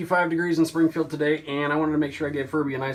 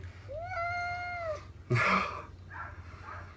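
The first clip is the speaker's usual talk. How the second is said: high laugh; 7 dB quieter than usual. Real, not acted. Laugh while bathing cat.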